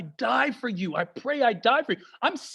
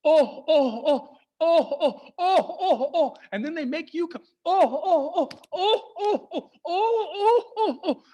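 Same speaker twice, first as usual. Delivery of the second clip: mock dad groaning; 6 semitones higher than usual. Persian dad laments bad news, hammed-up groaning. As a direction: wail oh oh like a news-watching dad. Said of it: "they love doing this".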